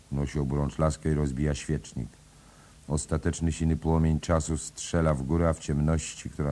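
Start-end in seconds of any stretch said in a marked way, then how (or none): none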